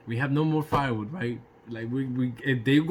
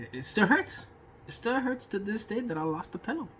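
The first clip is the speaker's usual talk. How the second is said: mock heartbroken; 8 semitones higher, 4 dB quieter than usual. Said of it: "before I start crying for my pillow".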